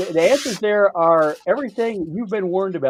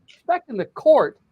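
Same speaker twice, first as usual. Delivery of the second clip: ordinary talk, nothing marked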